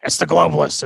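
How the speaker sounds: loud stage whisper, pitch dropped way down; a whispered, ranting Alex Jones bit; Alex Jones impression. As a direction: hiss the conspiracy at them in a forced whisper. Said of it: "Tell me, Alex".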